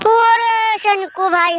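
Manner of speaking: high, slow drawn-out call. Pretend. Comedian hailing brother Shanku, calling out. Calls out to another person.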